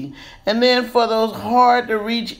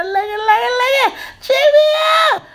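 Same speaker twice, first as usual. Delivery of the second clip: excited squeal. Real, not acted. Showing off the finished look.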